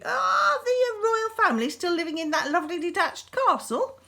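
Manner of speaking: a hint of a chummy beast; friendly beast. A narrator's reading. The beast chats friendly about the royal family, lightly voiced. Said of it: "beast became much more friendly".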